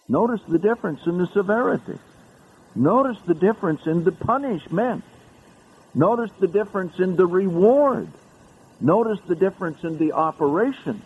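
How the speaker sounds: high, insistent hammering of notice; emphatic repetition. Real, not acted. Preacher hammering the differences to his congregation, high and insistent. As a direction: drive each difference home. Preacher stresses repeated contrast.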